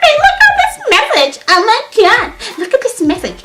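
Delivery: very high mock outraged squeal; hammed-up high-pitched indignation; imitated outraged roommate. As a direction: mimic her squealing to her boyfriend. Imitating roommate showing boyfriend message.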